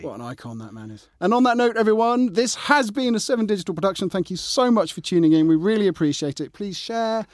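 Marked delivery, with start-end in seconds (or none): 1.2-7.3 cheery outro. Acted. Podcast sign-off.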